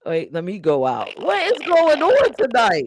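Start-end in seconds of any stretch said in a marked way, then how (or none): 1.2-2.9 high squeaky glitchy audio; pitched-up connection glitch; chipmunk voice. Real, not acted. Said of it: "that's you sounding like a chickmunk".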